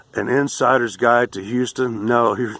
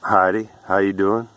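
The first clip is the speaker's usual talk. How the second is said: Texan greeting. Shown as a demonstration. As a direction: greet a friend the real Texan way.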